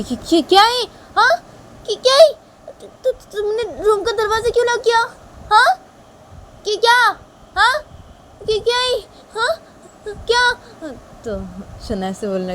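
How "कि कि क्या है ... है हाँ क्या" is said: very high, stammering panic; piercingly high, halting alarm; alarmed Shanaya. Acted. Shanaya alarmed by locked door.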